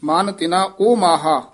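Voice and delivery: "ਓ" as a full open o; rounded long o in "ਓ ਮਾਹਾ"; open-mouth O. Shown as a demonstration. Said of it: "ਊੜਾ ਖੁੱਲੇ ਮੂੰਹ ਵਾਲਾ".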